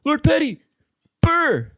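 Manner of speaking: high shouted rapper ad-lib. Shown as a demonstration. Podcaster jokes about dubbing himself, high rapper ad-lib. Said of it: "like a rapper".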